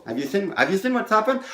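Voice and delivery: quick patter. Shown as a demonstration. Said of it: "I look at people".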